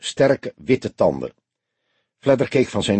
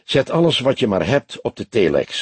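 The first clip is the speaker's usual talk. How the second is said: usual speaking voice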